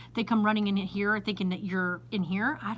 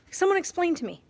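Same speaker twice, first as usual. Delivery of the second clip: exasperated appeal; 7 semitones higher, 3 dB louder, faster than usual. Real, not acted. Baffled, demands explanation for parking.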